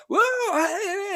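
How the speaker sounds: very high mock crowd cheer. Crowd cheering what they think is Matt Smith, high mock whoops. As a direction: whoop like a thrilled audience. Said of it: "there was like a".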